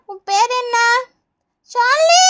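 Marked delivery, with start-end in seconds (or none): 1.7-2.2 put-on high, rising, stretched-out child yelp; crying out. Child answers nurse with her name as needle goes in, crying out. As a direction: turn the name into a drawn-out squeal. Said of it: "ஏன் பாப்பா இப்படி கத்துற?".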